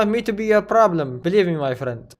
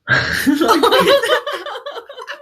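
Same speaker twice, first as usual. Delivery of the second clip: high laughter; 11 semitones higher, 3 dB quieter than usual. Real, not acted. Laughing at his own pun.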